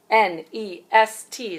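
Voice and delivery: slow, letter by letter; spelling out. Shown as a demonstration. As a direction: spell each letter out slowly.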